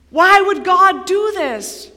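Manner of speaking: loud, high, drawn-out plea; anguished questioning. Acted. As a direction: cry it out as a sufferer. Voicing a sufferer's anguished questions.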